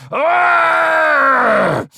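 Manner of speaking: loud, high-pitched roaring growl; drawn-out furious roar; angry growl. Acted. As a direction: let out a long enraged "arrgh". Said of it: "Mo growled and moved toward Alden".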